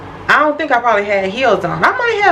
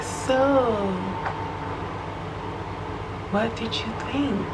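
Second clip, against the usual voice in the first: imitating her friend, softer and drawn out; slow soft friend imitation; 13 dB quieter than usual. To her friend after Bible study, a slow hushed imitation. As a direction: copy how the friend slowly asks. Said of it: "This is how she talked".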